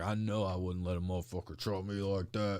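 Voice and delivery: a dad boasting he'd never take that chop; tough dad. Retold or imitated. Dad defending wrestling to doubters, loud laughing boast.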